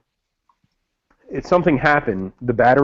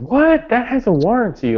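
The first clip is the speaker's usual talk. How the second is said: surprised; 10 semitones higher than usual. Retold or imitated. Reacting to mom trashing the light.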